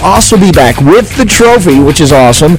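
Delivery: energetic announcer delivery, pitch lifting on openers; punchy, continuous broadcast patter; radio host segue. Real, not acted. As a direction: drive the show along like a live sports-radio host. Host reads segues over music.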